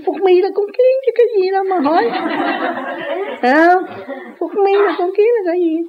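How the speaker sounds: very high, loud, laughing squeal; mocking lady's retort. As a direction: squeal it like the scoffing lady. (giọng bà đầm cao vút, vừa cười vừa nói)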